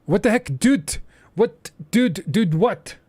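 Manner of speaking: high, loud rapid dudes; XQC dude bit. Retold or imitated. Imitating XQC confused, high loud hammed-up dudes. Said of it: "not accurate".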